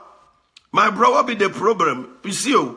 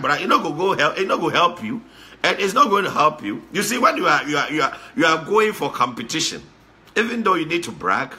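ordinary talk

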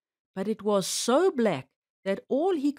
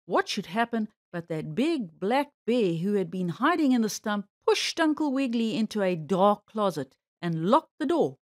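usual speaking voice

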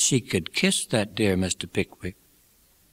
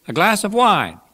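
high, raised, convivial offer; Pickwick inviting; 11 semitones higher, 5 dB louder than usual. A narrator's reading. Pickwick offers old acquaintance wine, high convivial call. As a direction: offer it across the table, pitched up and loud.

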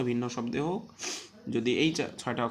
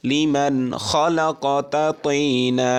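slow, loud chanted recitation; teacher chanting the verse for students; Quran recitation; 2 semitones higher, 11 dB louder, much slower than usual. Shown as a demonstration. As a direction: draw out each syllable in tajweed tune. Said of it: "পড়তে থাকুন".

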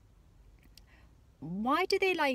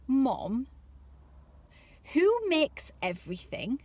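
lightly voiced small child; curious child; 3 semitones higher, slower than usual. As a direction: ask it like a wide-eyed little girl. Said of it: "once Brooke asked me".

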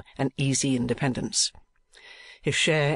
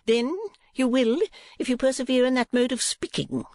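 much higher, reproving wife voice; scolding wife; 11 semitones higher than usual. A narrator's reading. Wife warning husband about his talk, high scolding voice. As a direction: warn him in a high voice.

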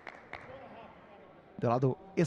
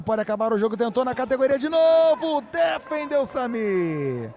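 rising excitement; 6 semitones higher, 3 dB louder than usual. Real, not acted. Building to a near goal.

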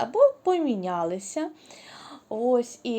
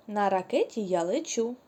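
ordinary delivery

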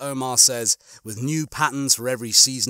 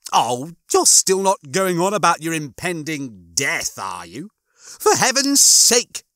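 exasperated, higher-pitched complaint; Rimmer; 5 semitones higher, 4 dB louder than usual. Acted. Rimmer tells Lister off about his impending death, exasperated. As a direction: scold him, rising to a loud, high exclamation.

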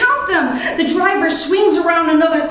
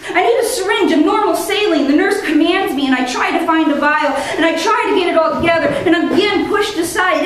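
ordinary delivery, nothing marked

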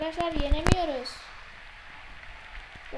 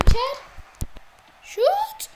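excited call; 11 semitones higher, 5 dB louder, slower than usual. Real, not acted. Urging a shot, voice rising.

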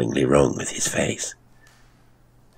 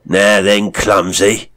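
loud, higher, rough retort; loud gruff rebuke; 5 semitones higher, 7 dB louder, slower than usual. A narrator's reading. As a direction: snap at a clumsy stranger. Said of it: "returned his angry stare".

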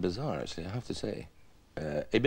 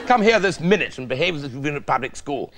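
mock English officer giving orders; bossy redcoat; 4 dB louder than usual. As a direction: scold like a caricature public-school officer. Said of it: "it was all the red tunics".

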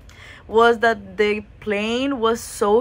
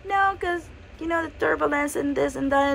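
mock flight attendant voice, much higher; 6 semitones higher, 3 dB quieter than usual. Flight attendant refusing the boyfriend's Coke request, mock high voice. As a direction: lightly imitate a high-pitched attendant saying no. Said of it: "She's like".

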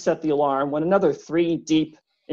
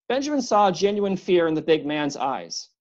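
ordinary delivery, nothing marked

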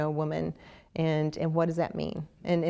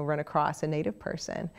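usual speaking voice

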